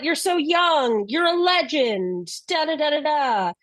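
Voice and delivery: high, sing-song retold protest; lightly hammed-up high-pitched pleading; mock pleading. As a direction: imitate an overwrought plea, trailing into filler. Mimics the wizard's melodramatic plea.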